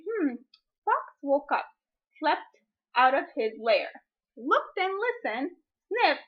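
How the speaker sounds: gentle read-aloud voice; story reading. Acted. Reads picture book aloud.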